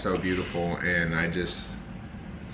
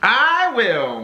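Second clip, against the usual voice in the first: loud, high emphatic declaration; emphatic vow; 13 semitones higher, 6 dB louder than usual. Shown as a demonstration. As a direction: belt it out like a vow. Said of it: "That's how I said it".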